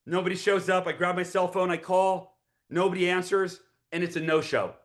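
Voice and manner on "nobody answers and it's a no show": ordinary talk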